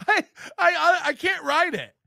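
ordinary talk, nothing marked

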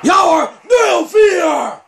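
high, loud jubilant call; goal shout. Real, not acted. Gamer celebrates the fourth goal, jubilant shout. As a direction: belt out the new score.

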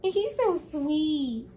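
high, slow cooing with a laugh; cooing baby talk. Retold or imitated. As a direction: melt over the kitten, cooing. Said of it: "I was like".

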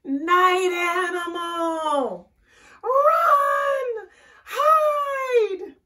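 long, high screamed alarm; panicked shouting. A narrator's reading. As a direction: shriek the warning, stretching each word. Animals panic at night animal.

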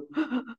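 put-on sharp high gasp of a crying child, then quiet; gasping sob. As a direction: imitate a child catching its breath while sobbing. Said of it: "crying hard and going".

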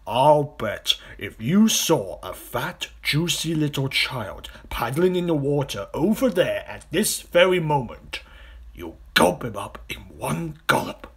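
loud, slow, building crocodile voice; taunting crocodile. Acted. Big crocodile betting the smaller one would gulp, loud and goading. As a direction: goad with greedy relish. Enormous crocodile figure in story.

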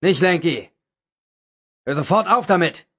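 high, loud order from afar; commanding yell; bellowing. A narrator's reading. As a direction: shout him down from nearby. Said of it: "brüllen".

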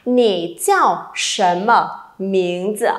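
slow, careful model question; slow read-along. Shown as a demonstration. Teacher leading students to read along, slowly. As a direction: lead the class slowly through it. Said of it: "Đọc với cô nào".

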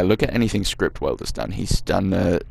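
normal talking voice

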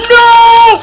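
loud high-pitched "No!"; panicked shout. Real, not acted. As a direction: scream it as the game goes wrong.

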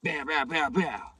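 vocal "bow-bow" banging; imitated loud knocking. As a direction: mimic someone pounding on a door with your voice. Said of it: "I knock as loud as I can".

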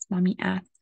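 usual speaking voice

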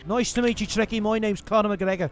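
cocky mock Irish accent, lightly done; a gamer playing brash Irish fighter Conor McGregor; swaggering put-on Irish brogue. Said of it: "Definitely sound like it".

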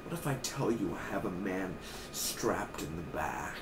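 quiet mock villain threat; Pope menace. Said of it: "he's like".

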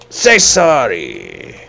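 loud, slow, falling mock-angry order; drawn-out demand. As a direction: stretch the order out, loudly. Last, drawn-out demand for apology.

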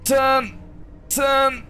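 high, pleading cries; urgent pleading. Real, not acted. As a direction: beg the vehicle to turn. Urging vehicle to turn.

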